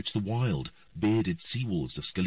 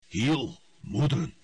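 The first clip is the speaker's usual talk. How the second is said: louder formal hail; loud greeting; 6 semitones higher, 4 dB louder, slower than usual. A narrator's reading. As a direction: call out the greeting. Earl greets the queen.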